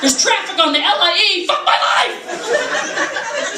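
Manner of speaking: high mock-anguished wail; overblown outrage. Driver complaining about trivial traffic, overblown outrage. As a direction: build to a squealed cry of fake despair. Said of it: "It's always like".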